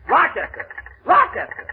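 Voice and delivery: high, building call into the phone. Acted. Boss shouting after his butler on the phone, calling out.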